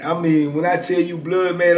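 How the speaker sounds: ordinary talk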